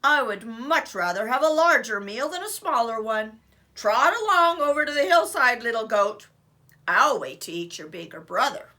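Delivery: booming greedy troll; loud troll voice. Acted. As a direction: boom it out.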